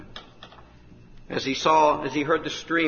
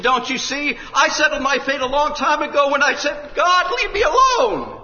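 high, loud despairing cry, slowing at the end; dying girl telling the preacher she is doomed; anguished cry; 10 dB louder than usual. Retold or imitated. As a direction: cry out her lost fate. Dying girl recalls her refusal.

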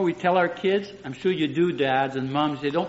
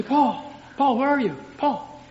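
put-on higher, anxious call searching for a missing child; worried calling; 7 semitones higher than usual. Shown as a demonstration. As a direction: call out like you fear something happened. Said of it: "different tone in my voice".

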